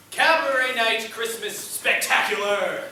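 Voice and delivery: loud, drawn-out showman's shout; hype shout. Real, not acted. Host launching the Christmas cabaret to the audience, excited shout. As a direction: whoop up the crowd at full volume.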